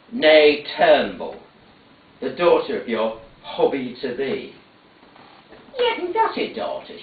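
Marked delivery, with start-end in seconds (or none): 5.8-7.0 put-on high, indignant old woman; indignant mother. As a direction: she won't hear of it, denying it high.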